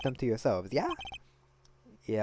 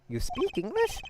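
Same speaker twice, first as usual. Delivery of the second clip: higher, rising surprised question; 11 semitones higher, slower than usual. Acted. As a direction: ask it in quick surprise. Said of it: "I mean".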